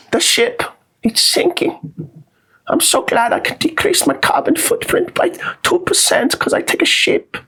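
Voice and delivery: mocking falsetto lament; very high impression of an activist on a sinking ship; hammed-up, high-pitched whine; Greta Thunberg. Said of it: "I hope her boat hits".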